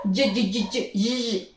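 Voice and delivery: repeated short voiced "j" bursts; teacher isolating the final j sound of "judge"; j sound demo. Shown as a demonstration. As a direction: drill "j, j, j" crisply.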